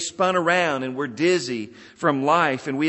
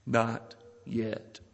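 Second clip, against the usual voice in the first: softer, lower, measured answer; quiet refusal; 5 semitones lower, 7 dB quieter than usual. Retold or imitated. Master answering people who look to him, quiet refusal. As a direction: say it low and gently, unhurried. Master's refrain, repeated in application.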